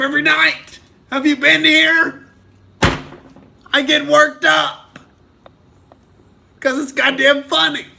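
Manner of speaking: crank the outrage up for the show; getting worked up. Real, not acted. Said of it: "I'm getting worked up 'cause this is the Outrage Cast".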